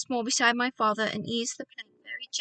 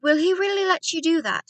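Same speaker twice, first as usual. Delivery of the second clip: eager question; 7 semitones higher, 6 dB louder than usual. Acted. Boy asks doctor eagerly.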